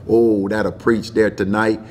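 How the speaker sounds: ordinary talk, nothing marked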